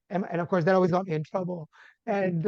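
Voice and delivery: ordinary talk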